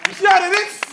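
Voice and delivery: high, loud delighted cry; excited cheer. Acted. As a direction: burst out thrilled at the kick. Coach thrilled by a good kick.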